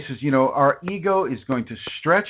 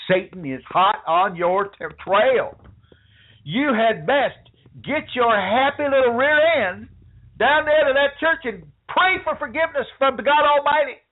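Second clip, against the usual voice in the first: high, hammed-up Southern preacher scolding; high mock preacher voice ordering you to church; mock hellfire preacher; 11 semitones higher, 3 dB louder than usual. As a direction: preach damnation like a revival minister, mocking. Mocking a fire-and-brimstone church preacher.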